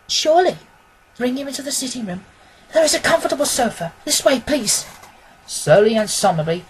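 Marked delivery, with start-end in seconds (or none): 0.1-4.9 a higher, quicker woman's voice; a lady kindly directing helpers; a hint of a gracious lady. A narrator's reading. Irene answers the crowd.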